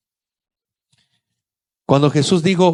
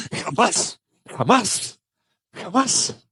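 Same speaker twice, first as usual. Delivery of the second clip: rising higher with each jamás, pleading; Peter's vow; 7 semitones higher, 8 dB quieter, much slower than usual. Acted. Peter vowing to Jesus he will never deny him, rising insistence. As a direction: swear it three times, climbing in pitch.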